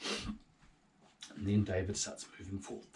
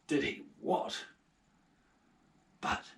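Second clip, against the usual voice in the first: ordinary talk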